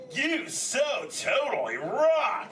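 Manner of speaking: slow, high, swooping surfer call; big drawn-out turtle cheer. Shown as a demonstration. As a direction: stretch every word to cue the crowd. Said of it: "whenever a green sea turtle says".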